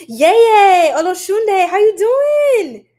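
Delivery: high, loud, drawn-out happy welcome; excited greeting. Real, not acted. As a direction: call out with delight to a friend.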